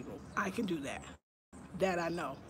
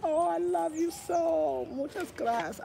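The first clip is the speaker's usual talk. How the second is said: effusive thanks; 8 semitones higher, 4 dB louder, slower than usual. Real, not acted.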